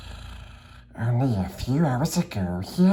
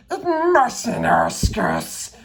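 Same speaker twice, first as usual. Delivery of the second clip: much higher, slow creature shriek; Gollum shrieking; 13 semitones higher, slower than usual. Acted. Gollum snaps at Bilbo, shrieking high. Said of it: "shrieked Gollum".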